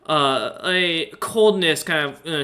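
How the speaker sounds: ordinary talk, nothing marked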